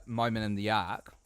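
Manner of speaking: ordinary delivery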